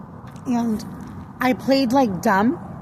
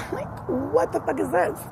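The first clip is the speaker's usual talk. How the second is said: high-pitched, laughing question; laughing disbelief; 8 semitones higher, 3 dB quieter than usual. Retold or imitated. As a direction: break into a laugh, voice jumping up. Laughing incredulous retold reaction.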